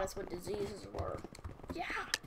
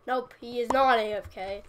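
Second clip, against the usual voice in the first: louder, emphatic nope; loud correction; 2 semitones higher, 11 dB louder than usual. Real, not acted. Gamer loudly correcting herself about an idle player. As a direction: call out the correction.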